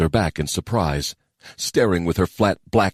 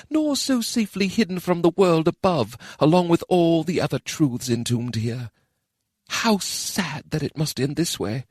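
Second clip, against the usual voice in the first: sad inner thought; 11 semitones higher than usual. Acted.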